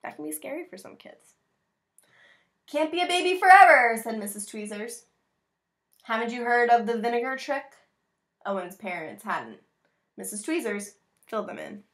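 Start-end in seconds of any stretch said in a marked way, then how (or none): 2.7-11.8 put-on reading to children. Reads picture book aloud.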